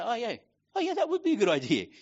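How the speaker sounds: light mocking oh yeah, quick and high; mock agreement. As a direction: toss off an easy agreement.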